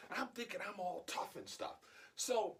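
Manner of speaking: ordinary delivery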